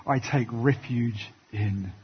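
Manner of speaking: soft, slow and hushed, almost prayed; prayerful cry of trust. Retold or imitated. Preacher voicing David's prayer to God, soft and slow. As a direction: breathe it out as a quiet prayer. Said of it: "cry out like David and say".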